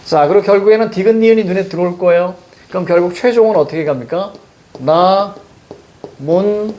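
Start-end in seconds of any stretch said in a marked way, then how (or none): 4.8-6.7 나, 문, 립 one syllable at a time with pauses; correct pronunciation demo. Shown as a demonstration. Teacher gives the final correct form, spaced syllable demo of 나, 문, 립. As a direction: space out 나, 문, 립, holding each evenly. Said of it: "이게 맞습니다".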